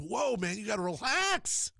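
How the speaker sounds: high, drawn-out plea to calm down; retold alarmed protest. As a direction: retell it as taken aback. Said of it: "I was like".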